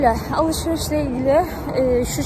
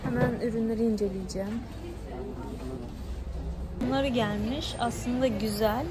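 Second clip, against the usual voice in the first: quiet at night; 8 dB quieter than usual. Real, not acted. Talking softly inside the shop.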